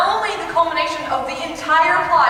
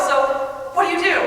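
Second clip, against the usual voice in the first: ordinary delivery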